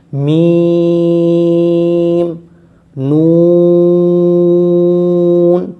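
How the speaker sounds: میم and نون held long, almost sung, on one breath; drawn-out letter names. Shown as a demonstration. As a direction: hum میم then نون to the end of the breath. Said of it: "پوری ایک سانس میں".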